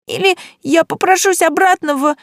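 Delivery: put-on high, loud child's cry; a little girl threatening in tears; shrieking girl. A narrator's reading.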